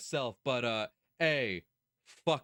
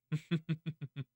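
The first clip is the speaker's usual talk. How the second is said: quick stuttering closed laugh; exasperated chuckle; 2 semitones higher, 4 dB quieter than usual. Real, not acted. Streamer fed up with a selfish character, exasperated chuckle. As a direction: let out a tense little chuckle.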